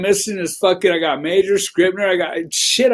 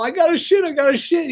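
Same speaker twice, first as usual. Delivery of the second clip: put-on a much higher, urgent kid's whine; desperate kid; 9 semitones higher, faster than usual. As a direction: beg like a kid who can't hold it. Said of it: "he's all".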